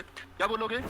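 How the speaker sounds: normal talking voice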